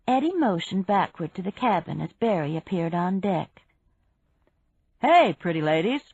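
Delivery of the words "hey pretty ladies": loud hailing greeting; calling out. A narrator's reading. Man greeting women from the boat deck, calling out. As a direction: hail them from the deck. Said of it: "he called".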